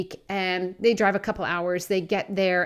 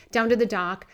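ordinary talk, nothing marked

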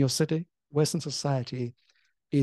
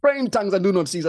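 urgent command; 4 semitones higher, 6 dB louder, faster than usual. Retold or imitated. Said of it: "I heard the Holy Spirit say".

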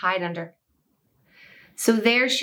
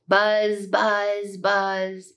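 buzzing bee; much slower than usual. Acted. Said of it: "she buzzed around the dog's head".

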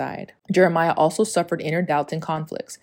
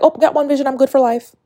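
high, louder breezy voice; mock complacency; 9 semitones higher, 6 dB louder, faster than usual. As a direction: act smug and carefree. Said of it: "It's not like".

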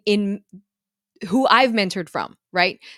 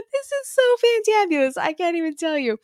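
gushing excitement; 9 semitones higher than usual. Real, not acted. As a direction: pitch jumps way up, thrilled and giddy. Gushing to viewers about son.

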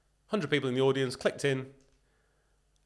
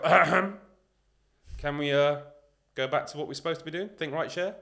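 1 mock polite interruption; 5 semitones higher, 6 dB louder than usual. Colleague interrupts a meeting politely.

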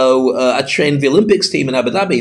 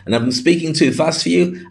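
ordinary delivery

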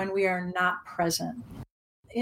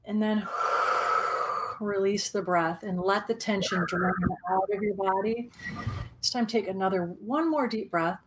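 calm guided meditation voice. Acted. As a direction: guide them gently into the present.

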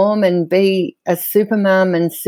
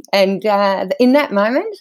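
usual speaking voice

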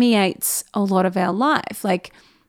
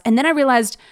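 ordinary talk, nothing marked

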